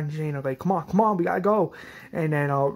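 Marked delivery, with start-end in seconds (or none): 0.6-1.7 urgent urging. Retold or imitated. Acted urging to leave.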